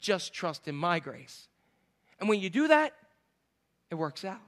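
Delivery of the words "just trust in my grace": slow, low and very soft; God's quiet reply. Acted. God answers a plea for money, low and soft. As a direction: hush it down into slow reassurance. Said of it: "And he says".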